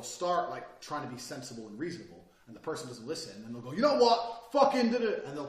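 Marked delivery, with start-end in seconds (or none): 3.7-5.2 sudden loud, high outburst; raised-voice mock blow-up; mock screaming. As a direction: snap into an imitated shouting fit. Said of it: "people intimidate by screaming".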